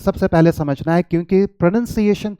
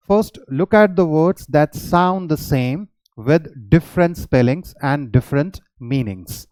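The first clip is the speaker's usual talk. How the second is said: slow, deliberate slide read; teacher reading the slide aloud; slow heading read; much slower than usual. Shown as a demonstration. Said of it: "reads slide heading slowly".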